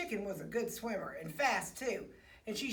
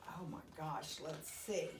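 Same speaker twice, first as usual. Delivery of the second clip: soft, hushed "oh my gosh"; hushed aside; 6 semitones lower, 10 dB quieter than usual. Real, not acted. Storyteller aside to children, hushed. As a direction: drop to a near murmur for the aside.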